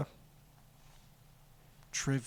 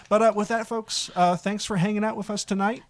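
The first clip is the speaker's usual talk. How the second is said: louder, put-on announcer sign-off; 5 semitones higher, 3 dB louder than usual. Host thanking listeners after teasing, playful announcer voice. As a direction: project it like an announcer closing the show. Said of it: "In my announcer voice".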